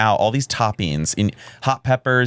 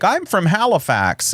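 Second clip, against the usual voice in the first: much higher, slow put-on voice; sing-song it like a proud local; mock local; 10 semitones higher, slower than usual. Mocking Canadians naming their city, high and slow. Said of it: "they're like".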